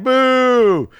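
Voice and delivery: loud, high mock boo; jeering like a heel wrestler. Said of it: "And he's like".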